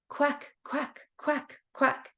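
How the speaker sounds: high quacking; duck quacking. Pretend. Duck sound in kids' rhyme.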